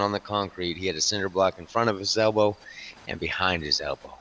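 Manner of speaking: ordinary delivery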